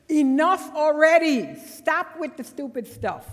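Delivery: exasperated shout of enough; exasperated outburst. Retold or imitated. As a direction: throw up your hands and shout it. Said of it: "throwing up my hands and saying".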